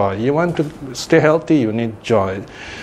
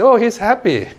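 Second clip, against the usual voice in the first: mock delighted exclamation; 10 semitones higher than usual. A teacher imitating an onlooker, a high-pitched surprised "oh, he's happy". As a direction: jump up high with a light "oh" of delight. Imitating a listener seeing a smile.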